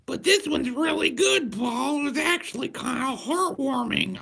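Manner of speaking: shrill mock fan voice, way up high and loud; high mock pleading voice. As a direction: squeal it like an overeager fan. Mocking a pushy fan's voice.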